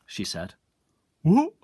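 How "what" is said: sharp, very high and loud what; startled boy; surprised what. Acted. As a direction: blurt it out through a mouthful. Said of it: "asked Stuart, his mouth full".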